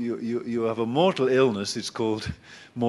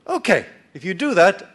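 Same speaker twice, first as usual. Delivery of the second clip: higher, louder, eager bargaining voice; Jacob bargaining with God; 9 semitones higher, 7 dB louder than usual. Retold or imitated. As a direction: haggle with God like a cheeky trader. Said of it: "he says to God".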